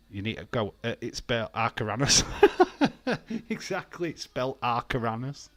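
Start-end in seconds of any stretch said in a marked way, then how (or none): 2.3-3.4 high burst of laughter. Real, not acted. Laughing at the spelling joke.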